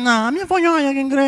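high, loud nasal insistence; honking, stubborn diner; nasal speech impediment. Acted. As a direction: insist through the nose. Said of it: "Agora vai falar fanhoso".

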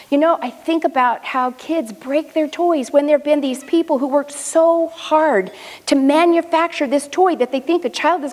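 hint of a seven-year-old's earnest sadness; lightly voiced little boy.

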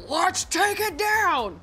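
rally the troops to tear it all down slowly; ruthless leader. Pretend. Host imagines guest as ruthless leader, slow high battle cry. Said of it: "or you're gonna be like".

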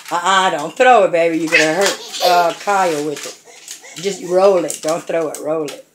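low, soft and calm instruction; gentle correction. Real, not acted. Mother correcting child softly.

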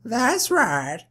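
high put-on lady voice; woman character voice. Tiger owner answering the visitor, high woman voice. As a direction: pitch it up for the woman. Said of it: "they have the exact same voice".

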